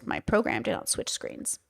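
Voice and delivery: under her breath, whispered; whispered aside. Real, not acted. Creator whispers an aside while her program fails to switch screens. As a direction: mutter it to yourself while fixing the screen.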